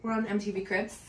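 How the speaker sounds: lightly hammed-up TV host intro, lifting at the end; mock MTV Cribs host. Joking to viewers as a mock celebrity host. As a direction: pretend she's hosting a celebrity house tour. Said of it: "I'm kidding, we're not on M T V Cribs".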